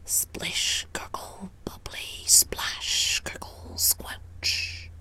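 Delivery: very soft whispered mumble; mumbled spell. A narrator's reading. Old frog casts her spell, mumbling in a whisper. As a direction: breathe the magic words under your breath. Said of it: "mumbled something that sounded like".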